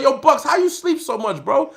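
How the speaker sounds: mock voice of a cellmate who can't believe how much he sleeps; cellmate asking. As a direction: ask it like an amazed buddy. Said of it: "he's like".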